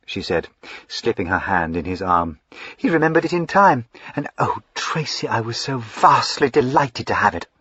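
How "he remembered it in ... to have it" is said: higher, excitable fine lady; eager young lady Lavinia. Acted. Lavinia gushing to her brother, high and eager. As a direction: gush up high like a spoilt sister.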